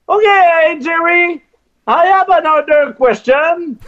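high, slow, earnest English of a francophone; nervous radio guy fumbling in English; awkward English on air. Retold or imitated. On air, a French radio guy nervously introduces himself in English. Retold eager English question.

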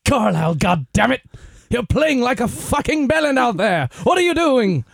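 put-on loud, high British accent yelling at a player; furious British coach bawling out his man; shouting in a British accent; angry British coach. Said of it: "faire un accent britannique".

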